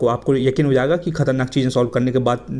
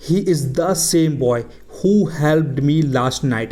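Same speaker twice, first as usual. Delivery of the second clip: slow, word by word example; slow example quote; much slower than usual. Shown as a demonstration. Teacher voicing Janvi telling her father, slowly. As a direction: drag out each word to teach. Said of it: "Janvi अपने पापा को क्या बताएगी कि".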